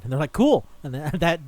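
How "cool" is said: high, loud, instant mock approval; chirpy mock sign-off; breezy agreement. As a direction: imitate someone far too happy to agree. Parents casually agree to trade son.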